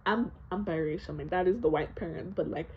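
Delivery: usual speaking voice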